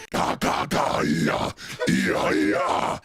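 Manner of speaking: unvoiced harsh demon babble; demonic gibberish. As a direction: rasp out nonsense like a possessed toy. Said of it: "speak in a demonic voice".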